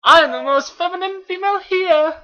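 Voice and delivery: put-on very high loud girly voice; shrill exaggerated feminine squeal; falsetto female voice. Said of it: "this is how females sound".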